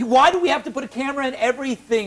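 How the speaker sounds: high, quick mock-outraged demand; faked outrage. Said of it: "am I faking my outrage enough?".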